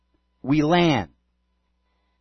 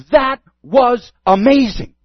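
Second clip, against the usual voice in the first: excited; 7 semitones higher, 7 dB louder, slower than usual. Retold or imitated. Friend texting about the conversation.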